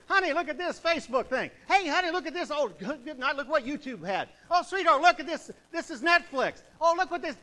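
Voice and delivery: husband eagerly showing his wife videos, excited. Retold or imitated. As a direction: call the wife over to look, thrilled. Voicing himself showing his wife screens.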